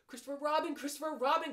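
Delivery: high, loud repeated call; crying out. Acted. Piglet to Christopher Robin at the door, crying out. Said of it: "cried Piglet".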